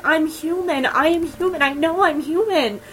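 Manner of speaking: high, pitiful pleading voice; sad pleading. Retold or imitated. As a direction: plead pitifully, imitating someone desperate to be believed. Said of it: "it's so sad, 'cause she's like".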